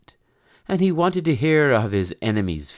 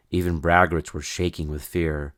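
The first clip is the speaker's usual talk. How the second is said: ordinary delivery, nothing marked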